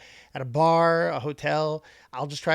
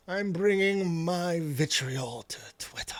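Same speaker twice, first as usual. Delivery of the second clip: slow, half-whispered melodrama; mock-menacing self-importance; slower than usual. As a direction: stretch it out in a breathy pompous threat. Mocking self-important online poster.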